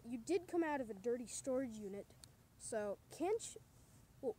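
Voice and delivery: usual speaking voice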